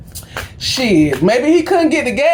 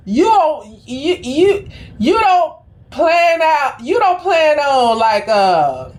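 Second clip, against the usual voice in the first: 1 sassy mockery; 6 semitones higher than usual. Mocking people buying gas.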